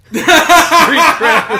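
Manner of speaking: high, loud, laughing repeat; laughing all caps. Retold or imitated. Repeating the chat's all caps line, laughing loud. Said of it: "they all type in all caps".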